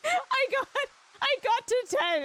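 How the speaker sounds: high, loud words through laughter; laughing. Real, not acted. Telling chat about the slip, laughing hard. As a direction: barely get it out for laughing.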